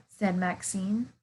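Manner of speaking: ordinary delivery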